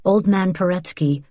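ordinary delivery, nothing marked

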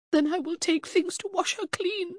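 very high, evenly pitched resolve; brittle denial. A narrator's reading. Mother planning to wash her dead daughter, high-voiced denial. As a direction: hold a high, steady tone.

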